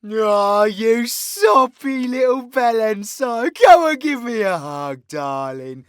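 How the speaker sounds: loud, drawn-out villain voice, gushing; big theatrical "darling" endearments; teasing villain character. Acted. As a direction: smother them in mocking affection. Creator plays his villain character teasing him.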